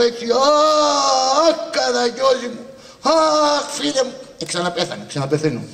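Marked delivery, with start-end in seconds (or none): 0.3-4.1 plaintive wail. Acted. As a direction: cry it out with grievance. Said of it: "πιάσ' το με παράπονο". (θρηνητικό, ψηλό «Αχ» με παράπονο)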